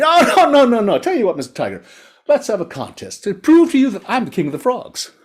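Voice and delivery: high, squeaky frog protest; high-pitched storybook frog, urgent; fast-talking frog. A narrator's reading. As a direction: talk the tiger out of it. Frog figure bargains for his life.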